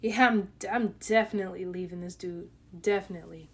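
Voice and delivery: low, soft, done-with-it aside; fed-up mutter. Retold or imitated. Wife muttering to viewers about leaving husband, low and soft. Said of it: "So I'm like".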